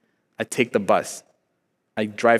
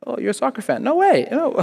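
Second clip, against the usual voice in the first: trying to be nice; 2 semitones higher than usual. Retold or imitated. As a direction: imitate small talk to win someone over. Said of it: "when you try to be nice".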